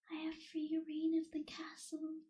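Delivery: ordinary talk, nothing marked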